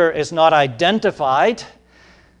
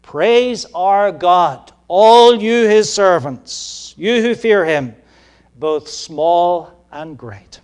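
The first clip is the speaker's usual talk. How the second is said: loud, slow, ringing proclamation of the verse; proclaiming scripture; 3 semitones higher, 8 dB louder, slower than usual. Acted. Preacher reading verse five aloud, loud slow proclamation. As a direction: declaim it like the voice from the throne.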